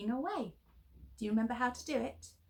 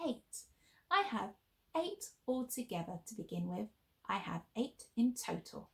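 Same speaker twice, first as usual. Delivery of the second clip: ordinary delivery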